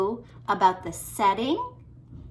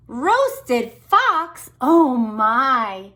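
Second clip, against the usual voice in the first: higher, louder alarmed fox; frightened fox; 4 semitones higher, 8 dB louder, much slower than usual. Acted. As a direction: panic at being dinner. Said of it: "Fox said".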